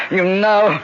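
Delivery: high, loud accusing snarl; exposed fury. Acted. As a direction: spit it out as the monster is unmasked. Dracula exposed by the Bible.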